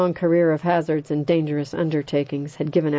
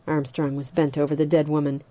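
usual speaking voice

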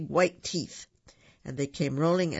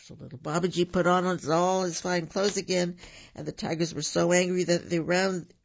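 plain reading voice

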